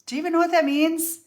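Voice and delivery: high, fast, disbelieving; incredulous question. Retold or imitated. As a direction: ask it sharply, shocked he'd repeat it. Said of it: "And I said".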